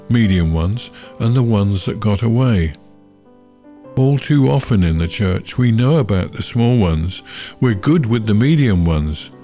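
usual speaking voice

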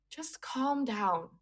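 slow, soft, soothing "just calm down"; imitating calm dentist. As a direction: hands up, soothe a panicking patient. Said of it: "And he just goes".

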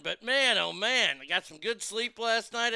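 ordinary talk, nothing marked

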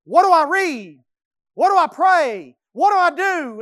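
loud, high eager questions; excited. Retold or imitated. Jacob eagerly asks what to do next, loud and high. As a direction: burst out eager to start. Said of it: "he gets excited".

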